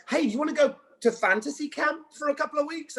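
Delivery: high-voiced excited invitation; mock enticing offer. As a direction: pitch it like an irresistible treat. Acting the producers' excited offer.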